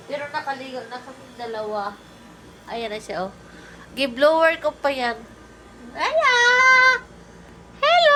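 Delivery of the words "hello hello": pet-talk shout; high-pitched calling. Real, not acted. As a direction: call the pet in a very high, loud sing-song. Greeting pet bird.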